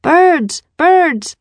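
put-on high, loud boy's street cry with falling ends; shouting boy. A narrator's reading. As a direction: yell to sell the birds.

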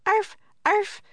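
high little dog barks; barking. A narrator's reading. The puppy barks from Jack's pack, high little yaps. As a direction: bark like a small excited puppy. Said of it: "barked Teddy".

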